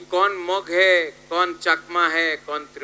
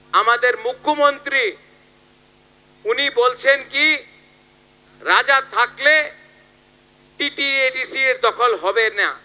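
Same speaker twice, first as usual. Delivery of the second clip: high, projected political speech; rally oratory; 7 semitones higher, 6 dB louder than usual. Acted. Politician reporting a rival's plot to supporters, high rally oratory. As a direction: lift the voice to carry over a crowd.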